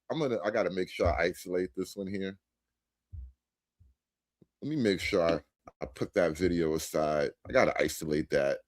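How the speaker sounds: low, soft, fast muttering to himself; muttered aside. Real, not acted. Talking to himself while clipping the video, low and fast. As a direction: mumble it while busy editing.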